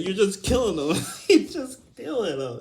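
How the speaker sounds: high-pitched, helpless laughing protest; laughing incredulously. Real, not acted. Arguing killing is no lesson, laughing in disbelief. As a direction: repeat it while cracking up. Cracks up repeating his point.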